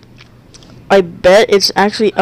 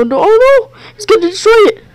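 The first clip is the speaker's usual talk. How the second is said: high, panicky cry of no; panicked squeal; 13 semitones higher, 4 dB louder than usual. Real, not acted. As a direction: panic as it destroys everything. Panicking as creature destroys planet.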